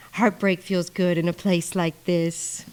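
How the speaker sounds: slow, soft, dreamy ad-read imitation; a light, lingering Hollywood voiceover; Nicole Kidman AMC monologue. Quoting the AMC ad to viewers in a slow, dreamy read. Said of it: "I quote that shit".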